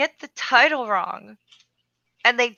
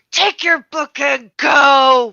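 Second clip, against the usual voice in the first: loud, slow, higher retold yell; mad shout; 4 semitones higher, 11 dB louder, slower than usual. Bookseller retells dismissing a customer, mad and shouting. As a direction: send them away with a drawn-out shout. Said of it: "I was so mad".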